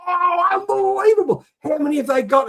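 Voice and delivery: high, loud hammed-up astonishment; mock amazement. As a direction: ham up the disbelief. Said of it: "I go".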